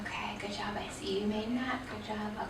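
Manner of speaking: soft whispered good job; whispered praise. Real, not acted. Teacher whispers praise to students at their boards. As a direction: hush it to each student.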